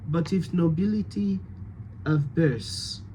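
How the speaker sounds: light French accent, "birth" as "birss"; hint of French, TH turned to S. Shown as a demonstration. Coach tests the ambassador's line, French accent.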